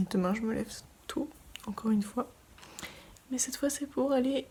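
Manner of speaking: tired late-night whisper; hushed, barely voiced. Real, not acted.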